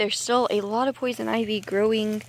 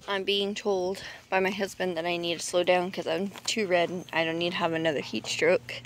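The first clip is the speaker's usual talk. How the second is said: ordinary delivery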